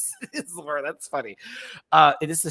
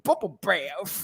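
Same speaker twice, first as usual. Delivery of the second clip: imitating her; 3 semitones higher, slower than usual. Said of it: "how she talks".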